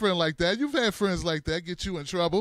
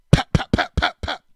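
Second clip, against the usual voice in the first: mock gunshot sounds; 8 dB louder than usual. Imitated gunshots.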